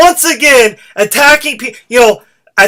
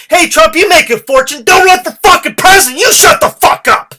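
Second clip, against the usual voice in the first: louder and ever higher, ending in a yell; angry rant building to a scream; 7 semitones higher, 4 dB louder than usual. Real, not acted. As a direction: build from scolding to screaming at him. Ranting at Trump, building.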